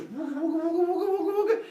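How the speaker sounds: high, rising wooga warble; whirling spear noise. Acted. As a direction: imitate a spear whirling through the air, climbing high and loud. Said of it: "the spear goes".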